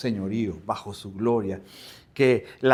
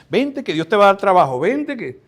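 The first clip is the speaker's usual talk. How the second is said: louder, higher mock sales pitch; mock eager invitation; 5 semitones higher, 6 dB louder than usual. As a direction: imitate someone urging a friend to come. Acting out a false-hope church invitation. (invitación insistente, imitada)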